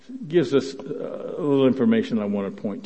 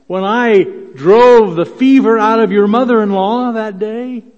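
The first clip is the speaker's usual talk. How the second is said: higher and louder, urgent appeal to friends; voicing Jesus pleading; 8 semitones higher, 9 dB louder than usual. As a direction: plead with the disciples, raising the voice. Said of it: "Jesus could have been saying something like this".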